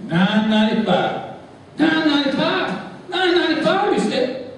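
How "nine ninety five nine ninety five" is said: much higher, incredulous repeat of the price; outraged disbelief. Acted. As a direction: balk at the price like a brash cowboy.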